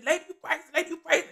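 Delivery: a light impression of a man running off; imitating the robber. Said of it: "He kept calling me crazy".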